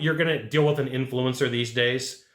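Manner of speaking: ordinary talk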